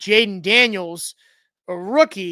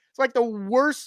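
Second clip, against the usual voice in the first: ordinary talk, nothing marked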